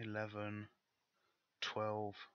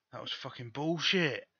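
loud, higher-pitched angry outburst; angry protest, raised voice; pissed off; 4 semitones higher, 6 dB louder than usual. Real, not acted. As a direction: curse it out in real frustration. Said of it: "why I got so fucking pissed off".

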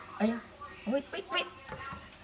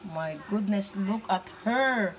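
ordinary talk

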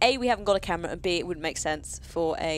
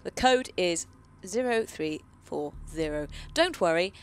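louder read-aloud voice; reading a note; 2 semitones higher, 3 dB louder than usual. Acted. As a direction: read it like a found letter. Reads game note aloud.

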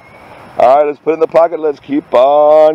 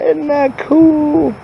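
delighted; much slower than usual. Real, not acted. Shows off a found butterfly.

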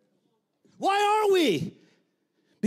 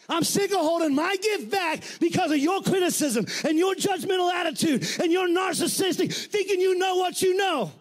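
fiery preaching; 8 semitones higher than usual. Acted. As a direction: push it higher with each phrase. Preaching rant rises.

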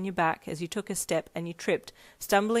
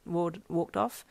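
ordinary delivery, nothing marked

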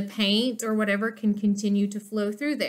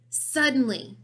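ordinary delivery

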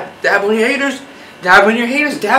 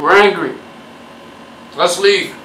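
normal talking voice

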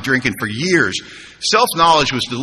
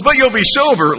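much higher and louder, bright consolation; upbeat punchline; 10 semitones higher, 4 dB louder than usual. Retold or imitated. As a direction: toss it off cheerfully. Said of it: "He goes".

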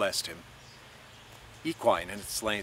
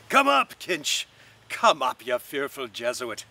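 coarse call; 4 dB louder than usual. A narrator's reading. Buck Mulligan calling down the stairwell to Stephen, coarse loud call. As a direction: bellow it down the dark stairs. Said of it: "called out coarsely".

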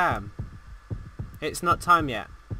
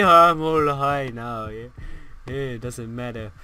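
stoned guy; 7 semitones lower than usual. Acted. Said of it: "looks like he's just done some drugs".

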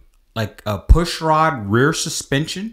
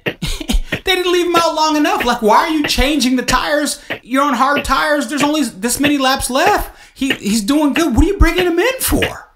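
high-pitched incredulous ranting; worked-up, disbelieving protest; exasperated rant; 13 semitones higher, 4 dB louder than usual. Real, not acted. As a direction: rail at the team's bad call, voice raised high. Ranting at team's pit strategy mistakes.

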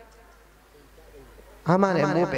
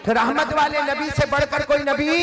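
higher and louder rousing call to the crowd; preacher rousing the congregation to answer; 8 semitones higher, 6 dB louder than usual. Shown as a demonstration. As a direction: lift it up and stir the crowd. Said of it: "अरे बोलो ना".

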